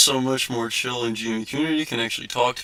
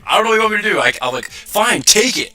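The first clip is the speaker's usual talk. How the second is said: raised, higher exasperated yell at the customer; retold yell; 8 semitones higher, 5 dB louder than usual. Clerk yelling back at the arguing customer, exasperated shout. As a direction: throw up your hands and shout it.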